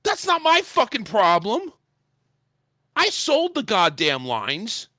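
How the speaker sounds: high-pitched angry protest; indignant retort. Retold or imitated. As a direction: throw the blame back, high and outraged. Retold row with the supervisor.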